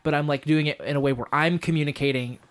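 ordinary talk, nothing marked

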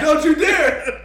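loud, slow, laughing warning; mock warning shout. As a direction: yell it like a dare, laughing. Echoes warning at the geese.

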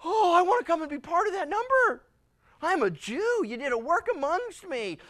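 high, loud begging to join; desperate to be let in; eager plea. Acted. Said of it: "you're gonna say".

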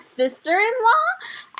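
high, slow, startled sister-in-law?; surprised question. Retold or imitated. Denise, startled at being called sister-in-law, high slow surprise. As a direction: ask it in slow disbelief. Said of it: "she looks up weird".

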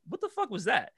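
high-pitched mock disbelief; puzzled reenactment of being caught off guard; retold bafflement. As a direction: react baffled, pitch jumping up. Retold reaction to sucker punch.

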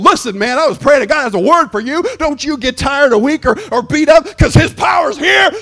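high, loud, confident exhortation; bold encouragement. Retold or imitated. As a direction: fire up your pastor. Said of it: "calling your pastors and going".

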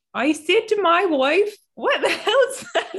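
a high, yelping Kiwi farmer; New Zealand accent. Pretend. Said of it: "That's pretty good New Zealand".

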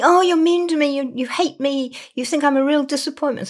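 high, loud hurt whine, words tumbling out; whiny upset client. Retold or imitated. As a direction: imitate a wounded, tearful accusation.

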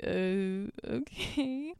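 uneasy, quiet little okay; awkward okay. Retold or imitated. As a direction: trail up softly, as if left standing awkwardly. Girl left standing, awkward.

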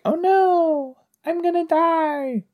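very high falsetto damsel wailing; squealing damsel. Pretend. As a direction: shriek it like a fainting heroine. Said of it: "I wanna be a damsel in distress".

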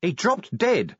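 mild shout. A narrator's reading. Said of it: "Vimes shouted".

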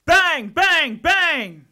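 loud spoken bangs; loud knocking. Acted. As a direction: pound the words like knocks on a door. Knocking at the door.

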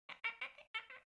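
high chirping cat hunting call; cat chirping noise. Retold or imitated. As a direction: imitate a cat chattering at birds. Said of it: "my best impression of the hunting noise".

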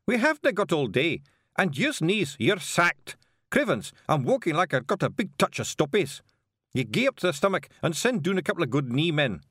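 high, loud Scots griping; Feegle giving orders. Acted. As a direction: fire the knees like a foreman. Sacking the knees.

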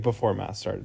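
ordinary talk, nothing marked

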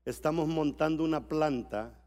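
usual speaking voice

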